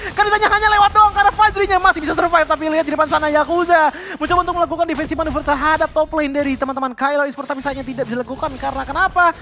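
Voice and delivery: high, loud hype calling; excited play-by-play. Real, not acted. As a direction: ride the fight at full pitch. Caster hyping the fight.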